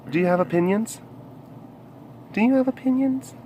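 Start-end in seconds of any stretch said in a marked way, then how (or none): 2.3-3.3 soft, high cooing question. Real, not acted. Asking a pet for opinions, high baby talk. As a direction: coo it up high.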